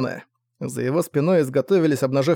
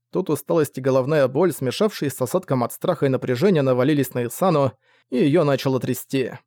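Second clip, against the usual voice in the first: usual speaking voice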